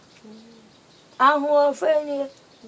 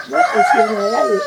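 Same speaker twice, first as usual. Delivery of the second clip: high excited; 4 dB louder than usual. Acted. Excited praise of the house.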